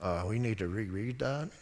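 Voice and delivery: low, soft mock-dopey voice; inattentive listener. Preacher imitates a listener who wasn't paying attention, dopey and low. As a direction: play someone who has zoned out, low and soft. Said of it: "Like I wasn't paying attention".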